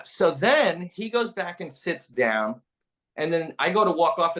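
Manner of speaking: ordinary talk, nothing marked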